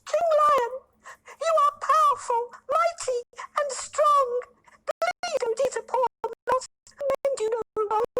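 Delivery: very high squeaky flattering mouse; tiny pleading mouse. A narrator's reading. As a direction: sweet-talk the king in a squeak.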